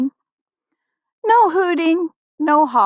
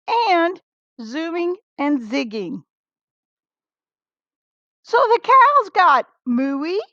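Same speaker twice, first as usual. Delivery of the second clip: higher, lively storybook voice; excited read-aloud of the noisy schoolyard; 7 semitones higher than usual. A narrator's reading. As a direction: let the chaos bubble up in the voice. Reading noisy recess chaos.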